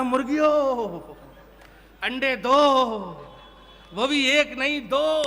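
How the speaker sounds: hammed-up drill order, stretched endings; mock commanding. Man ordering assembled hens to lay, mock command.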